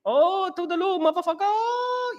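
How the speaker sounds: high, far shrill-pitched Chow voice from Hangover, drawn out slowly; Chow impression. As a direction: squeal it up high like Chow, stretching motherfucker. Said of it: "you're like Chow from the Hangover".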